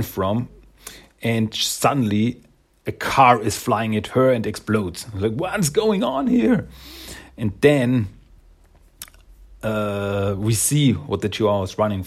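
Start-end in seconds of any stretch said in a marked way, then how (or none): 5.3-6.7 high, drawn-out mock alarm; acted high-pitched disbelief; alarmed bewilderment. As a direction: cry it out slowly, pitch way up. Car explodes next to heroine.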